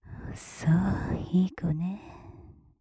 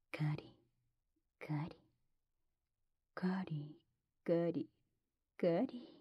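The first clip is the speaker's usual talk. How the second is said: whispered "カリ" one at a time, with pauses; slow repetition; 10 dB quieter, slower than usual. Acted. Teasing the listener before the pace picks up, slow repeated whisper. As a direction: space out each soft "カリ". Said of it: "ペースが速くなる".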